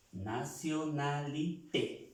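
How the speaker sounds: "Nationalité" said slowly in French, stress on the final té; French pronunciation. Shown as a demonstration. Teacher showing students the French word, slow and careful. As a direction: spell out "Nationalité" syllable by syllable, landing on té. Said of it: "al francés, ¿cómo dirá?".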